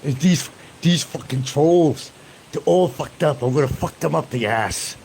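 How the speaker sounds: mock German accent, loud and slowed; loud mock German-accent rant; German accent impression. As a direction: ham up a German-accented rant about trolls. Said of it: "I sound like Werner Klinker".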